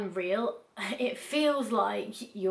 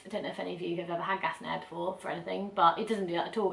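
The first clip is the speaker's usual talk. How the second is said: ordinary delivery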